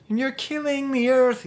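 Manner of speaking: high, loud, drawn-out mock outrage; mock rage. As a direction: wail it like an overwrought protester. Mimics impotent rage of protest songs.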